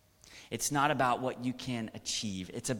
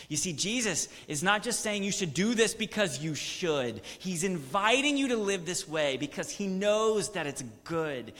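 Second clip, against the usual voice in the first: higher and louder, passionate proclamation; fervent preaching; 8 semitones higher, 6 dB louder than usual. Acted. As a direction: preach it with rising fervor. Rising sermon passage.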